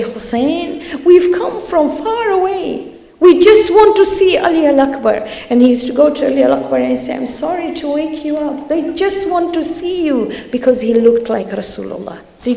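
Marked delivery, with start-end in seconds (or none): 0.0-5.3 much higher, eager calling voice; eager visitors calling. Retold or imitated. Night visitors calling to Imam Hussein, eager and much higher. 7.1-10.3 gentle apology. Retold or imitated. Father gently wakes his son.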